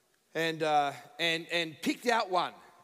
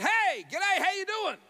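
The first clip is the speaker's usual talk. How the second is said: high, loud, chirpy hello; overeager greeting; 13 semitones higher, 4 dB louder than usual. Retold or imitated. Tired passenger greeting his seatmate, overeager. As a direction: greet with far too much gusto.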